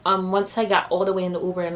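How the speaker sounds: ordinary delivery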